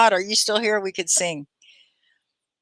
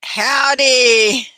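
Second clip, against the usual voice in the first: Texan howdy; 6 semitones higher, 5 dB louder than usual. Pretend. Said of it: "howdy, you know, I'm from Texas".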